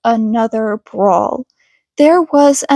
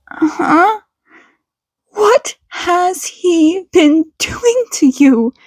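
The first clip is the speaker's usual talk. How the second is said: upset mother; 5 semitones higher than usual. Acted. Mother asking her son about the bullying, tearful. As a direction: hold back tears as you ask.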